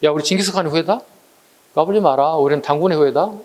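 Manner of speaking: ordinary talk, nothing marked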